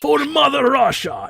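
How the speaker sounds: high, loud hammy Russian shout; host hamming Russian patriotism for his co-host; mock Russian battle cry. Loud hammed-up Russian cry. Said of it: "half Russian".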